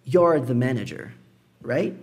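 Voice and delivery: "You're the manager" with quick "you're"; "You're the manager" contracted and quick. Shown as a demonstration. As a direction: clip "you are" into a fast "you're". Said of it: "contraído, mais rápido".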